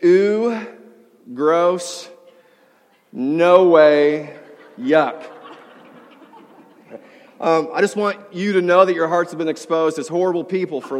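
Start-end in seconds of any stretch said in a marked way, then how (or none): 0.0-5.2 mock grossed-out kid; disgusted. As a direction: say it like a disgusted little girl. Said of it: "she says something along the lines of".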